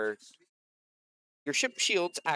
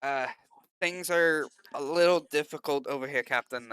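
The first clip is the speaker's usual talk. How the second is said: lightly put-on Irish lilt, pitched higher and louder; Irish accent; 4 semitones higher, 5 dB louder than usual. Officer reporting trouble to the captain, in an Irish accent. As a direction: slip into an Irish accent for the character. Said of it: "I've taken on an Irish accent for him".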